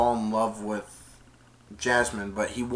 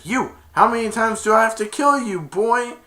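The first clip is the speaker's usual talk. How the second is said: mock angry villain; 9 semitones higher, 5 dB louder than usual. Jafar threatening Aladdin.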